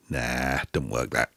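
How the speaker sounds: low, soft, dismissive murmur; dismissive verdict. Retold or imitated. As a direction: drop right down in pitch and volume to write it off. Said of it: "I look at it and go".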